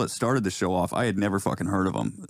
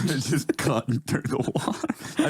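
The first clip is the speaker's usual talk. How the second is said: laughing; 5 semitones higher, slower than usual. Real, not acted. Repeating the joke through laughter.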